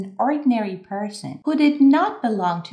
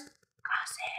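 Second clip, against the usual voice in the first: hushed, whispered aside; playful gossipy whisper; conspiratorial whisper; 14 dB quieter than usual. Pretend. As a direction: whisper it like a juicy secret. Playfully confides the word gossip.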